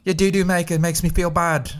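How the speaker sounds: Simon Cowell. Retold or imitated. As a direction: deliver a verdict as an English judge. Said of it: "like, Simon Cowell to be like".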